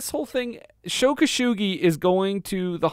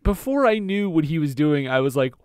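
normal talking voice

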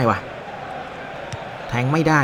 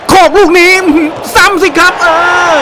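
higher, louder burst as the ball goes in; excited goal yell; 13 semitones higher, 6 dB louder than usual. Real, not acted. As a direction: shout it out with joy. Goal finally scored.

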